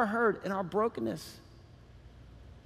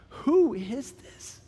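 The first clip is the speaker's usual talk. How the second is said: "Who is this" higher and drawn out slowly; awed emphasis; 5 semitones higher, much slower than usual. Real, not acted. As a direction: stretch "Who" and "is" in wonder. Repeats the question for awe.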